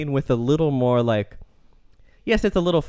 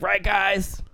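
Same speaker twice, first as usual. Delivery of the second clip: mock buddy appeal; 7 semitones higher than usual. Mocking Chris Rock's tone.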